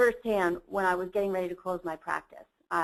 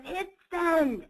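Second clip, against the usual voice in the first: imitated booming command, pitched up high; 9 semitones higher than usual. Mentor ordering a hesitant lawyer to send, imitated booming voice. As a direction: boom it out like a mentor giving an order. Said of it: "in his booming, deep voice".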